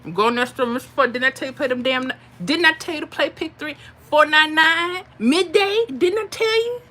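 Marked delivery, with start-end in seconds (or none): 1.1-6.8 playing a part. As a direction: nag him about the lottery numbers, rising. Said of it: "play the part".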